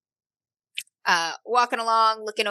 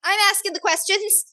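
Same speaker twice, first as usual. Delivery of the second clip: put-on high-pitched little girl taking charge; bossy orphan girl; 12 semitones higher than usual. Knife-wielding girl to the questioning stranger, bossy and high. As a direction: cut them off in a higher child's voice.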